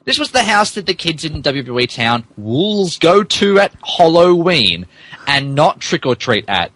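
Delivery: usual speaking voice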